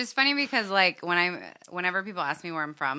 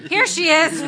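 high, loud laugh through it; laughing while pointing her out; 10 semitones higher, 9 dB louder, slower than usual. Real, not acted. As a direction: giggle out the reveal. Laughing reveal.

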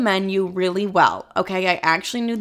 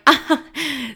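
higher awkward burst of laughter; 6 semitones higher than usual. Real, not acted. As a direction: laugh it off nervously. Laughs at customer's reaction.

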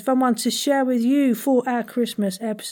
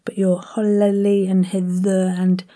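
ordinary talk

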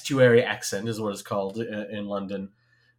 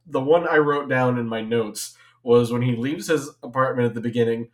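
ordinary delivery

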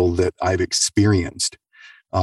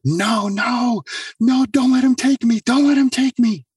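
freaking out. Retold or imitated. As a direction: beg desperately not to be taken. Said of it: "freaking out".

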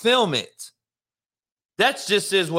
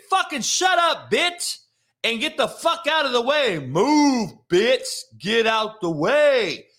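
angry yell; 7 semitones higher than usual. Retold or imitated. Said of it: "if the majority would say".

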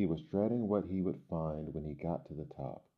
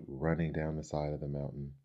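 plain reading voice

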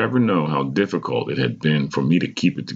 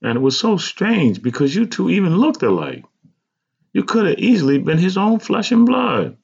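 much higher-pitched mother voice; mother's animated voice; 3 dB louder than usual. A narrator's reading. Mother marveling at child's likeness to him, high animated voice.

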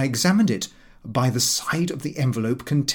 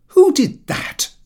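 high, loud and slow indignant question; loud demand; 8 dB louder, slower than usual. A narrator's reading. Pooter in the dark demanding who punched him, loud. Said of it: "I said loudly".

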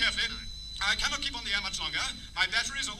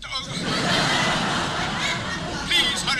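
desperate plea; 4 semitones higher, 9 dB louder, much slower than usual. Acted. Sinking sailor begs for speed.

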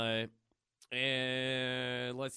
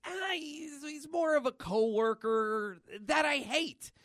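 high, slow put-on voice, sneering; sulky guy voice; 11 semitones higher, 4 dB louder, slower than usual. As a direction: drawl out the hate, pitched well up. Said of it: "He's like".

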